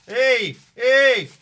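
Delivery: loud, high, delighted calling out; excited shout. Real, not acted. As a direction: shout with joy at the gift. Excited at opening a gift.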